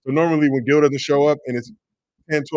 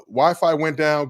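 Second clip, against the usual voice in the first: ordinary talk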